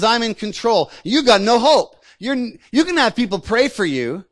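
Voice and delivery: taunting giant. Acted. Lying enemy taunting people who have lost hope, bullying. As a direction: lord it over a helpless victim. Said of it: "he taunts us".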